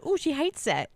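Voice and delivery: teasing. Acted. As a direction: tease your friend playfully. Teasing her co-host.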